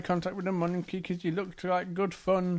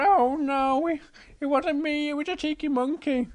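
high, protesting cub voice; Little Bear; 8 semitones higher than usual. Acted. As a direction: plead innocence as a little bear. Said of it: "And Little Bear goes".

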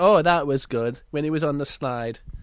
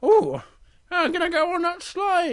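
high, eager cub; Little Bear voice; 12 semitones higher, 4 dB louder than usual. Acted. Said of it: "And Little Bear, he thinks".

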